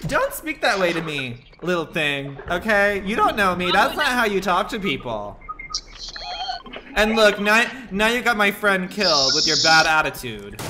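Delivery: loud, indignant telling-off; scolding. Real, not acted. Streamer scolds rude teammate for cursing at her, loud telling-off.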